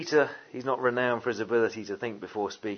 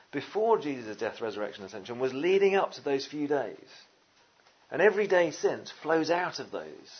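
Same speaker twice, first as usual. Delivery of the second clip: ordinary delivery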